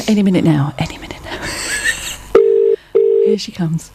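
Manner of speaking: hushed suspense whisper; mock suspense whisper. Host waiting for the guest to pick up, whispering. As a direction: whisper it as if waiting for something big.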